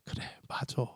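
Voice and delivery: normal talking voice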